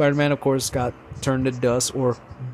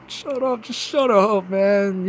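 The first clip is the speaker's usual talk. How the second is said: choked-up shut up; 6 semitones higher, slower than usual. Retold or imitated. Teary reaction to Spider-Man's death.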